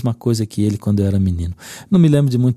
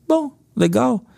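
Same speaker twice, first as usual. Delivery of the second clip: put-on high-pitched little kid voice, few words; much higher, put-on child voice; child's brief reply; 13 semitones higher, slower than usual. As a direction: imitate a child giving a minimal answer. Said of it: "poucas palavras".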